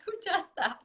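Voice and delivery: high, giggly self-mockery; laughing. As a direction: laugh at yourself as you ask. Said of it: "which makes me laugh".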